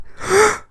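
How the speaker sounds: very high, theatrical gasp; mock shocked gasp. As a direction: suck in air as if scandalized. Mock shock at host's views.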